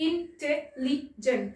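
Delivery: "Intelligent" split into four beats; "intelligent" slowed, each syllable separate. Shown as a demonstration. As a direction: count out "intelligent" as in, tel, li, gent. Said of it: "দেখুন চারটে".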